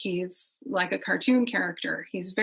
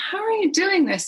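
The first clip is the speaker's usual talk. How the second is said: high, louder amazed question; 10 semitones higher, 4 dB louder than usual. Retold or imitated. Mother asking her son how he built it, amazed. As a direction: marvel at what he built. Mom amazed by son's game boat.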